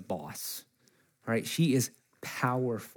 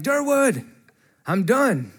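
mock proud call; 7 semitones higher, 5 dB louder, slower than usual. Boy proudly calling to the farmer.

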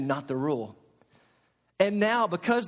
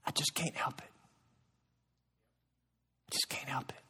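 a breathless whisper, much softer; whispered excuse; 6 semitones lower, 12 dB quieter than usual. Retold or imitated. Preacher voicing a believer's excuse to God, whispered. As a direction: whisper it like a helpless confession.

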